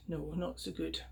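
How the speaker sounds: "No, not so good" dropped to a hush. Acted. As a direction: murmur it to yourself. Piper repeats quietly.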